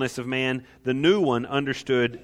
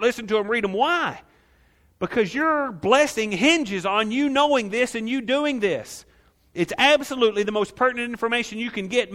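higher, driving sermon voice; fervent preaching; 7 semitones higher than usual. Acted. Preacher urging the congregation to learn the commands, fervent. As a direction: press it home to them.

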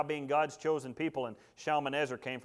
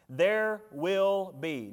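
"will" slowed and hit hard, louder and higher; stress on will; 6 semitones higher, 7 dB louder, much slower than usual. Shown as a demonstration. Preacher insisting to the congregation, stressing "will". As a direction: lean on "will" as certain. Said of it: "not might be, not should be, not could be".

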